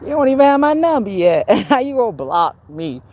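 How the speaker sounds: high laughing jab, mocking. Real, not acted. To the number-blockers, taunting: high mocking laugh. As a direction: laugh at them while taunting.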